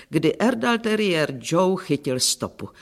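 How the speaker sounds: ordinary delivery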